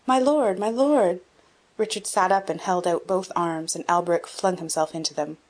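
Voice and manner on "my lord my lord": joyful cry. A narrator's reading. Friend greets the returned duke.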